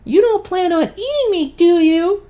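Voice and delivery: very high, tiny fairy voice; sweet high fairy. A narrator's reading. Fairy asking Viet nervously, tiny high voice. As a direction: squeak it out, small and sweet. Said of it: "sweet, high-pitched voice".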